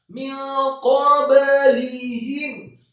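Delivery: slow chanted word with stretched syllables; Quran recitation. Shown as a demonstration. Teacher models the word for learners, Quran recitation.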